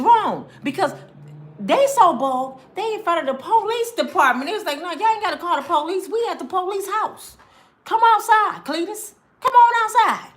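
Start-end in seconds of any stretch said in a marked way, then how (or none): 4.8-10.3 mock taunting crowd. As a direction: taunt the police like a brazen crowd outside their station. Mocking people taunting the police.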